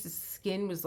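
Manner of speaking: normal talking voice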